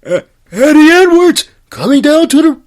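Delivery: first rough try at the announcer voice; Josh Matthews impression. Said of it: "I gotta hear Josh Matthews".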